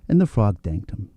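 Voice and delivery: ordinary delivery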